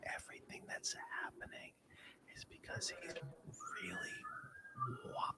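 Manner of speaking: soft unvoiced whisper into the mic. Real, not acted. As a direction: whisper so the stream barely hears. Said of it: "can you hear me when I'm whispering".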